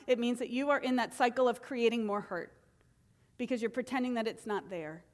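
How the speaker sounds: usual speaking voice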